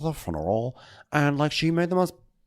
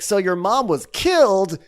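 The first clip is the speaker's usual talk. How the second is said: high, louder, bluntly cheerful host; blunt mock Andy; 10 semitones higher, 5 dB louder than usual. Imitating Andy blurting out the mother-in-law's death, high and loud. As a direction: blurt it out breezily. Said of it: "He's like".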